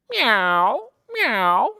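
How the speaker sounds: loud meowing meow; cat meow. Acted. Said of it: "What sound does a cat say?".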